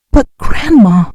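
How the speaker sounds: put-on high, drawn-out child's plea; sobbing protest. A narrator's reading. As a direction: protest tearfully to grandma, understated. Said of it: "Emma sobbed".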